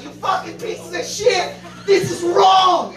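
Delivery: high, drawn-out mock indignation; crusading outrage. Crusader girls condemn the guys, shrill mock outrage. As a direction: imitate a righteous girl shrieking her disapproval. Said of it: "the Crusaders, they're just like".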